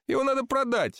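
high-pitched, matter-of-fact suggestion; lightly acted voice of reason; reasonable remark. A narrator's reading. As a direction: offer it as plain good sense, pitched high. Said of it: "заметил месье Подлинник с обычной рассудительностью".

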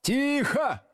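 high, drawn-out shout; barked order. A narrator's reading. Teacher silencing a noisy class, high bark. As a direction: bellow for silence over the din. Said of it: "гаркнул Слушкин".

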